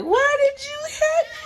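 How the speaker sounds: put-on little girl wailing at her grandma; crying child. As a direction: wail like a hurt little girl. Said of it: "So I'm like".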